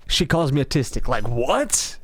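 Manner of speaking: usual speaking voice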